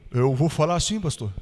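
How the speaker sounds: shy man. Retold or imitated. Pastor voicing the shy miner: low timid mumble. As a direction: shrink into the words like a bashful giant. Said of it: "Meio tímido assim".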